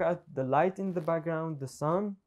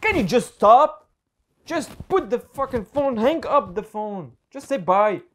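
loud, high, exasperated shout; angry yelling; 6 semitones higher, 10 dB louder than usual. Real, not acted. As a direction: snap at him to hang up. Brother interrupts the recording.

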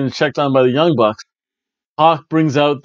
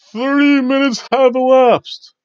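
much higher, slow announcer call; ring announcer; 12 semitones higher, 3 dB louder, slower than usual. Retold or imitated. Host imitates the arena announcer, slow and higher.

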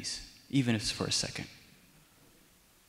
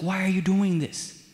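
mildly acted plea, higher-pitched; begging; 5 semitones higher than usual. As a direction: plead as if hurt and bewildered. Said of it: "He's begging Saul".

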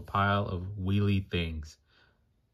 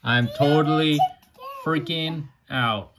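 panicked; 7 semitones higher, 5 dB louder than usual. Acted. Peg panics in story.

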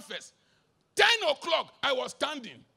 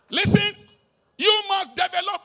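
sudden loud call to listen; 7 semitones higher, 10 dB louder than usual. Real, not acted. Preacher to congregation, loud call to listen. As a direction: bark it out to grab the room.

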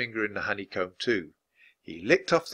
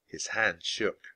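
usual speaking voice

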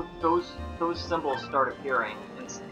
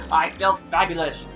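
affected fluting fop. Acted. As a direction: brag like a vain dandy. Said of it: "Flavio's voice".

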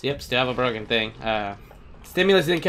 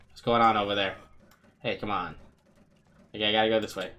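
ordinary talk

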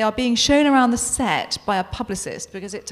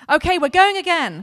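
louder call across the set; booming; 7 semitones higher, 5 dB louder than usual. Acted. Crew member calling the next take, booming. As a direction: boom it out like a crew chief. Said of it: "comes a booming voice".

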